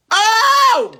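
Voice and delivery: sudden high cry out; overwhelmed cry. Real, not acted. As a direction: let it burst out of you. Overcome with emotion.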